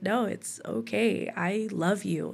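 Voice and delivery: soft, slow reassurance; retold tender comfort, spoken softly; gently reassuring. Husband reassures her as she comes out.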